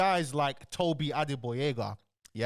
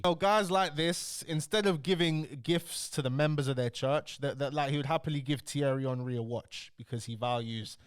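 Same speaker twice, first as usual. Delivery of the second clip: ordinary talk, nothing marked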